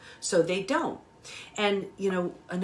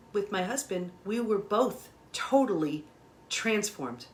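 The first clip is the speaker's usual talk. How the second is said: usual speaking voice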